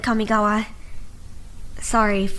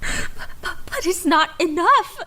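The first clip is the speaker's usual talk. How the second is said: crying; 8 semitones higher than usual. Acted. Overwhelmed medic breaks down crying.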